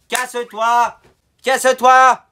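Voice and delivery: sudden loud yell to shoo a bird; shouting. Real, not acted. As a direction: bark it out loud and high. Shooing a pigeon off window.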